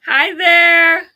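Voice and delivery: high, sweet voice for a little one. Real, not acted. Greeting his baby.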